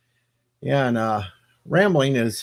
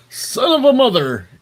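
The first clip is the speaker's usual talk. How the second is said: high, loud comic exclamation; hammed-up cry of exasperation; mock outburst; 13 semitones higher, 6 dB louder than usual. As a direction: yell it as a jokey curse. Acted outburst in a comic bit.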